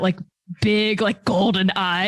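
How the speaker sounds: ordinary talk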